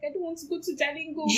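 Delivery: high, whiny protest; a hint of tearful pleading; mock whine. The daughter tells her sister about Jalingo, mock whining.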